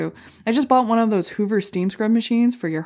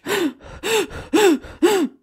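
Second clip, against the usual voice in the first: mock gasp; 12 semitones higher than usual. Comic miming a manual airbag, sharp high gasp. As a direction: gasp like hyperventilating into a tube.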